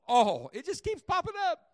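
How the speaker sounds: loud, slow hammering of "All"; preacher driving the word all home; preaching. Acted. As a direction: thunder the verse out to the church. Congregation answers, preacher thunders back.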